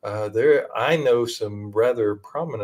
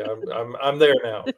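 ordinary talk, nothing marked

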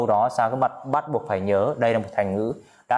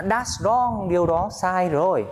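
high-pitched protesting reply; acted objection; 7 semitones higher, slower than usual. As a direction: object in a raised, sing-out teaching voice.

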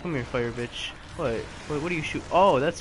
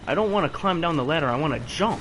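louder, faster frustrated rant; frustrated complaint; 3 semitones higher, 7 dB louder than usual. Real, not acted. Gamer arguing with character's ladder climb, loud frustration. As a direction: push it out fast and fed up.

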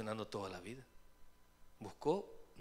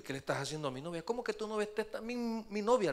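jealous protest; 7 semitones higher, 4 dB louder than usual. Retold or imitated. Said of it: "le dijo".

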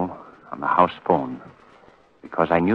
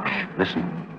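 hushed warning; 10 semitones higher, 9 dB quieter, slower than usual. Acted. Hushing Margot, waiting for the snake.

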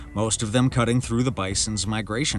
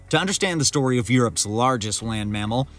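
normal talking voice

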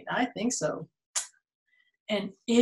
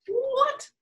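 sudden high mock gasp of what; mock surprise. Reacting to the story for a child, high mock surprise.

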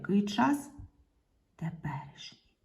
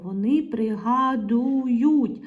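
slow, loud "пригадують" in long syllables; emphatic sounding out; 3 semitones higher, 5 dB louder, slower than usual. Shown as a demonstration. Teacher changing the verb to find its ending, drawn out. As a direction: stretch ПРИГАДУЮТЬ right through the ending.

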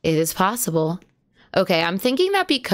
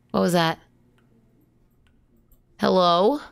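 usual speaking voice

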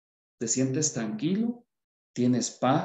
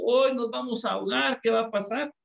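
mock panicked wail; 9 semitones higher, faster than usual. Preacher mocking the panicking disciples, hammed-up frightened wail. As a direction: voice jumps much higher, a hammed-up frightened cry. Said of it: "se puso a llorar y dijo".

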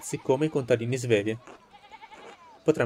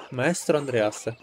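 ordinary delivery

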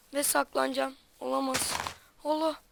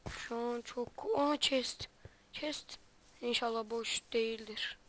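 very soft, hushed voice while hiding; 3 semitones lower, 13 dB quieter than usual. Real, not acted. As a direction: keep it low so nobody hears. Hiding from enemies in game.

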